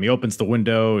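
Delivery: normal talking voice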